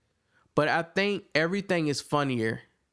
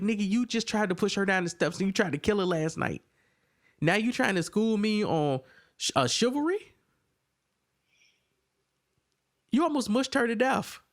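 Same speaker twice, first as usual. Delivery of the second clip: higher, mock-outraged disbelief, imitating a viewer calling out Tracy; incredulous retort; 8 semitones higher than usual. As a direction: scoff at the hypocrisy in a raised, disbelieving voice. Viewer mocking Tracy's hypocrisy.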